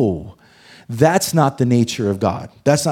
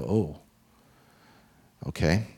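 much lower and softer, taken aback; stunned hush; 8 semitones lower, 9 dB quieter than usual. Retold or imitated. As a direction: let it sink in quietly. Stunned reply to God.

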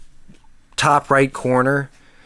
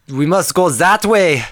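stiff German villain accent, raised pitch; clipped German villain accent; movie villain German accent; 7 semitones higher, slower than usual. Shown as a demonstration. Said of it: "I really don't wanna do a German accent".

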